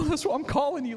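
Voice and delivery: high, laughing through the line; laughing reply. Retold or imitated. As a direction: laugh as you admit why you called. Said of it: "I was like".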